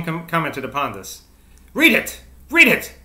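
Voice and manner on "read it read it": high, loud, short outburst; exclaimed command. A narrator's reading. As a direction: urge it sharply, pitched way up. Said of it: "she murmured".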